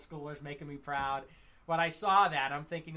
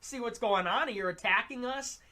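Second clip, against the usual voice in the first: retold protest; 8 semitones higher, 5 dB louder than usual. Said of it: "I'm like".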